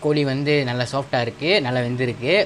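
words between bites; talking while chewing. Real, not acted. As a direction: mouth partly full of food. Seen 0: Took bites just before; still holds chicken, mouth seems working.

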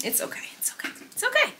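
breathy whisper, soothing herself; whispered self-reassurance. Real, not acted. Frustrated creator calming herself down, whispering. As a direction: whisper it to calm yourself down.

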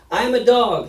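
loud, high roar as Goliath. A narrator's reading. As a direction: roar it out at a small opponent. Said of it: "he roared at David".

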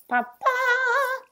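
high, loud trumpet call. Acted. Elephant seal trumpeting through his trunk. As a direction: blare it, last note held long. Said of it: "trumpeted through his trump".

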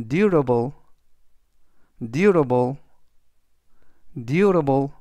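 teacher modelling "durable" for learners to repeat; careful model pronunciation. Shown as a demonstration. English teacher reads "durable" aloud for students, slow and careful. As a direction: say "durable" slowly and clearly, DUR-a-ble. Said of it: "이라고 발음하시면 되겠고요".

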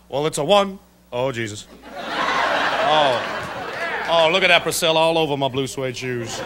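lightly voiced [name], low and breathy; a hint of [name] mumbling after finishing too soon; [name] impression. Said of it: "[name] as a premature ejaculator".

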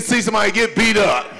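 normal talking voice

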